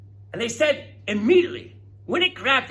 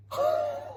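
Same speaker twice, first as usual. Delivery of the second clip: gasp. Real, not acted. Gasp at hatching.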